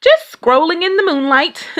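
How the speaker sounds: high, innocent fib; innocent Papa. A narrator's reading. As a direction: play it innocent, too brightly. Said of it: "Papa said".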